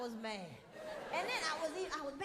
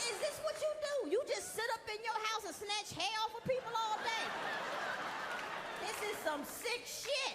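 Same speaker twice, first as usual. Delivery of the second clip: outraged yell; 8 semitones higher than usual. Retold or imitated. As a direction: rant at her in disbelief. Yelling at friend after waxing.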